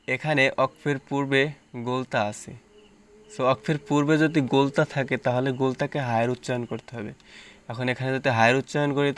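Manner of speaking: usual speaking voice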